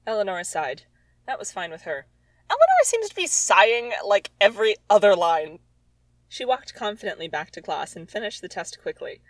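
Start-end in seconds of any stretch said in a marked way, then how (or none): 2.5-5.6 exasperated outburst. Real, not acted. Mocking her teen writing, loud exasperated outburst. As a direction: throw up your hands at the text.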